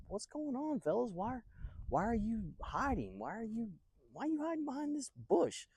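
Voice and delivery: high-pitched, baffled questions; baffled questioning. Acted. David asks soldiers why they are hiding, baffled.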